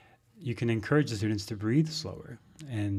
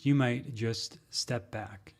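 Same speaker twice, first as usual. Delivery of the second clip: slow; much slower than usual. Shown as a demonstration. Slow advice to step back.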